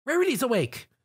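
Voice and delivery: high glad call; cheerful announcement. A narrator's reading. Twilight cheers that Rarity is awake, high. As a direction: announce it gladly. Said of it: "Twilight cheered".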